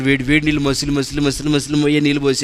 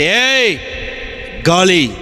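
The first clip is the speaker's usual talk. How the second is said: commanding the storm; 3 semitones higher, slower than usual. Acted. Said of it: "అంటాడు".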